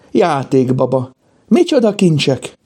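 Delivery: high-pitched delight over treasures; excited cry. A narrator's reading. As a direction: exclaim high, thrilled by the find. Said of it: "kiáltotta".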